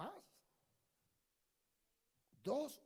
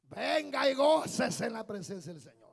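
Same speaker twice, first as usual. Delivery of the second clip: loud preacher's call, easing off at the end; rousing exhortation; 9 dB louder than usual. Real, not acted. Preacher urging absentees to come rejoice, rousing and loud. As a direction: call them in with a big opening.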